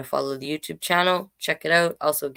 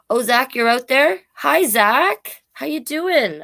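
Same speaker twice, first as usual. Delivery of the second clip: excited greeting; 9 semitones higher, 6 dB louder than usual. Real, not acted. Greeting a child in audience.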